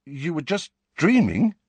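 uncertain. A narrator's reading. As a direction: stumble into a doubtful suggestion. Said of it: "said Ron uncertainly".

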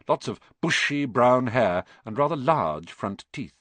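plain reading voice

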